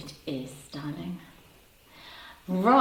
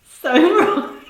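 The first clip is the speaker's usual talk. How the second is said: high and loud through laughter; laughing shriek; 14 semitones higher, 10 dB louder than usual. Real, not acted. Host losing it at the chat, laughing. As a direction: squeal it out mid-laugh.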